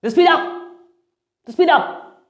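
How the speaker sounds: high-pitched, hurried burst; speeding up. Shown as a demonstration. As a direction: rush it out high, like a panicking speaker. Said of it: "they just speed the sentence".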